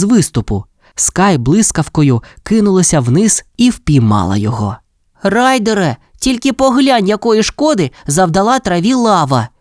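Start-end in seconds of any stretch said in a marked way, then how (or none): 5.2-9.5 high, dismayed exclamation; flustered. A narrator's reading. Chase shows Ryder the damage, flustered. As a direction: cry out in dismay, pitch lifted. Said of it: "розгубився гонщик".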